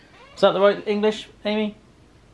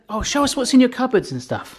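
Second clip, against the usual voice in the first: lightly voiced eager viewer, higher pitch; a hint of pleading fans, pitched up; viewers' request; 8 semitones higher than usual. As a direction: mimic curious viewers in a higher voice. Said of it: "they're like".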